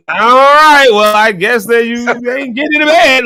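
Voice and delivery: high, loud mock resignation; exasperated giving up. Husband retelling his last try at waking his wife, exasperated.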